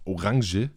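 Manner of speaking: Orange said the German way, "o-RAHN-zhuh"; German Orange with guttural r and final schwa; German pronunciation. Shown as a demonstration. Explaining German to the hosts, Orange pronounced in German. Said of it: "in German it's".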